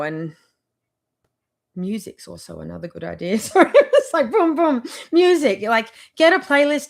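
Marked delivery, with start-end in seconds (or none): 3.4-6.9 high, loud words through laughter; real laughter breaking in; laughing. Real, not acted. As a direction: giggle through the beat. Laughs while miming music.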